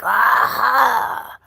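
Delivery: a short loud mock yell "Ah!"; imitating a screaming king. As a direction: let out a sudden acted scream. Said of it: "like, screaming".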